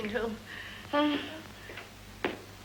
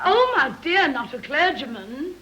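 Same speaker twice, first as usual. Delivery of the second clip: put-on stagey old movie actor; 3 semitones higher, 5 dB louder than usual. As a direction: gasp it out, overly thrilled. Shocked gush at the news.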